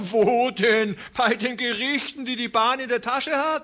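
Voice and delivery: a rancher scoffs at trusting the courts; scornful retort. A narrator's reading. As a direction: scoff at the idea. Mocking reply after laugh.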